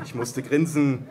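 plain reading voice

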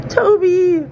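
loud call across the beach. Real, not acted. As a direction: shout a name to someone far away. Calling out to friend at sea.

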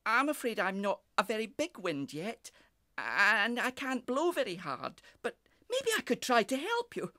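high, gentle-hearted character voice; sympathetic little breeze. A narrator's reading. Small breeze offers help to the cockerel, sympathetic. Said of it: "The little breeze was very sympathetic".

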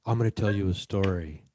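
campfire storyteller. Pretend. Printer telling scary tales round the campfire, mock ominous. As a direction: tell it like a campfire horror tale. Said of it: "their own version of a campfire".

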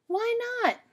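put-on child rabbit questioning mother; squeaky child protest. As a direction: protest like a little bunny.

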